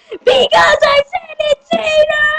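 high-pitched cartoon shriek; exasperated shout. Answering why it is Aider, high exasperated shout.

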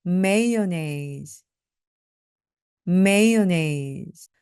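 mayonnaise stressed on the first syllable; mayonnaise said meone. Shown as a demonstration. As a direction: lean on the start of mayonnaise. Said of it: "강세가 반드시 있어야".

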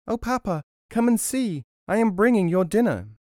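put-on high-pitched little boy's cry; child calling out. A narrator's reading. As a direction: call to father from afar, rising in volume. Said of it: "he began to cry".

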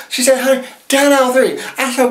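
high, sassy hostess voice pitching a product; sassy infomercial host JJ. Acted. Host to viewers, sassy high-pitched pitch. Plays drag character JJ hosting infomercial.

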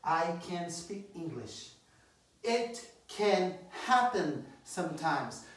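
slow, careful teacher read; slow model for repeating. Shown as a demonstration. Teacher modelling phrases slowly for repetition. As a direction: lay each word out slowly. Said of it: "Você tem que repetir".

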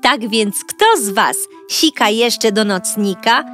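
ordinary delivery, nothing marked

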